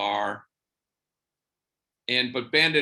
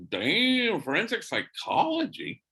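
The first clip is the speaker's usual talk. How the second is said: high, rising surprised question; 10 semitones higher than usual. Retold or imitated. Priest hearing her career choice, surprised. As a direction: caught off guard, impressed.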